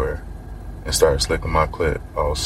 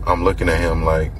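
ordinary delivery, nothing marked

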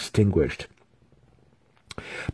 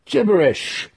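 high, loud dismissive retort; sharp retort; 5 dB louder than usual. A narrator's reading. Student scoffs at his master's spell, high dismissive retort. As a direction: throw it back high and scornful. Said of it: "Massage retorted".

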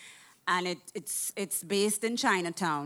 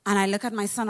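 usual speaking voice

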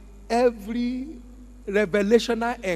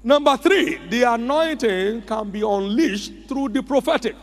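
preaching at full force; 4 semitones higher than usual. Acted. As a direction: lift the voice and proclaim.

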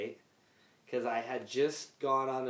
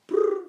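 high loud trilled ring; phone ring imitation; 8 dB louder than usual.

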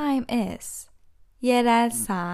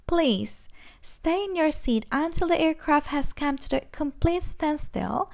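ordinary talk

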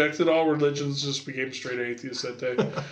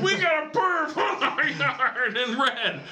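mock caller; 10 semitones higher, slower than usual. Joking about Santa in yard.

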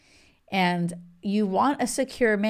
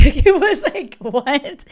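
laughing disbelief; 6 semitones higher, 8 dB louder than usual. Real, not acted. Disbelief at the cheating story.

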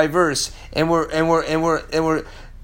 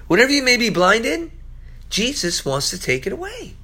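ordinary delivery

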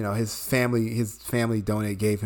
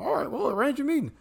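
much higher voice of an obliging IBM exec; mock executive reply; 12 semitones higher than usual. IBM executive happily agreeing to the meeting, high mock voice. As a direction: play the exec who instantly agrees. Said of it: "be like".

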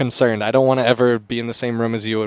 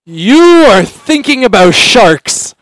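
very loud, much higher, slow; loudest sleep talk; 10 semitones higher, 14 dB louder, slower than usual. Retold or imitated. A friend talking in his sleep at the sleepover, slow loud declaration. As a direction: boom it out slowly like a sleep-talker. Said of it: "like, the loudest".

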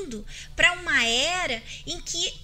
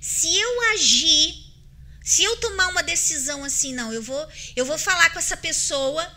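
louder, higher, impulsive outburst; mock angry resolve, pushed loud; acting out anger; 4 semitones higher, 8 dB louder than usual. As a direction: play the hothead about to confront someone. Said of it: "na hora que eu tô com raiva".

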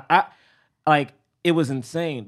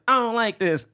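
put-on little kid; 9 semitones higher than usual. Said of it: "he's like".

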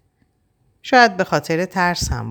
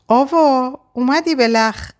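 cheerful call; 11 semitones higher, 4 dB louder than usual. A narrator's reading. Sister opens door, calls out.

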